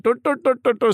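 high-pitched nonsense syllables, "do, do, do"; mock accent babble. Director mimicking the accent he loves.

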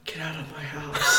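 soft, slightly high mumbled imitation; hushed mock night terror; subdued sleep-talk. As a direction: softly imitate a sleeper repeating a scream. Retold sleeper repeating it, subdued.

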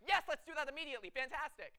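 high, loud burst of excitement; eager inner voice. Retold or imitated. Impulsive part of her brain jumping at an idea, excited. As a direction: jump at the idea like a puppy. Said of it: "the other part of my brain jumps".

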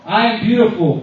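loud affirmation for the crowd to repeat; booming motivational speech. Real, not acted. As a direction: proclaim it for the room. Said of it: "please repeat after me".

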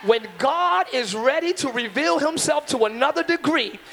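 building from firm to shouted climax; sermon climax over organ; preaching at full force. Acted. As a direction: preach it up to a roaring peak.

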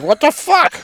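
high, startled what the fuck; outraged. Retold or imitated. To wife as she drives past the bar, a high outraged yelp. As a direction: yelp it in disbelief.

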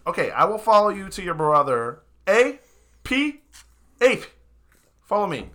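high, loud wizard calling his apes; pompous wizard. Acted. Wizard agrees and calls his apes, high and loud. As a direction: summon the bodyguards with pomp.

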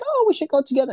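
high-pitched, over-friendly salesman; killing with kindness. Acted. Salesman turning up at a client's house, sugary and high. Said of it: "kill you with kindness".

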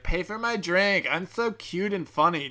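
high, slow, mock-cutesy woman; mocking customer impression. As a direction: mimic her demanding, self-satisfied plea in a high voice. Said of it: "she'd be like".

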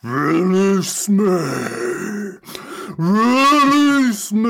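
a trapped demon roaring to be freed; demonic command. Acted. Willow reads demon's words.

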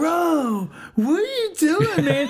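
high, loud dopey voice; mock the stoned kid's confused protest; imitating the hole watch kid. Retelling how the stoned hole watch kid reacted, high dopey imitation. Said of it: "the kid is like".

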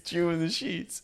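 suggestive. Pretend. Punning on slogan.

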